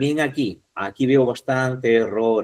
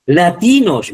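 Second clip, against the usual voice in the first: "Latinos" with a loud, high TI; emphatic la-TI-nos; stress on penultimate syllable; 6 semitones higher, 8 dB louder, slower than usual. Shown as a demonstration. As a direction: punch the middle syllable of "Latinos". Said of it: "mire, latinos también".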